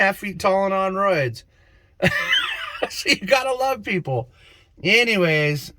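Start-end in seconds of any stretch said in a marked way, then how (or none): 2.0-2.8 very high-pitched cackle of amusement; laugh. Real, not acted. Laughs at own joke. 2.9-4.3 high, laughing delivery; real amusement lifting the pitch. Real, not acted. Laughing at own joke.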